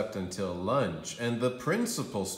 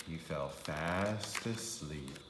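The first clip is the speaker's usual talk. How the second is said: fading sleepy; 5 semitones lower, 8 dB quieter, slower than usual. A narrator's reading. Drifting off.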